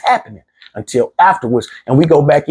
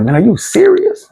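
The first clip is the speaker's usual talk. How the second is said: disbelieving; 9 semitones higher than usual. Retold or imitated. Fiance hearing what he did, imitated disbelief. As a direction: imitate her shock. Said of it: "she was like".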